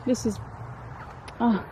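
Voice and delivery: ordinary delivery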